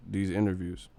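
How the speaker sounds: ordinary talk, nothing marked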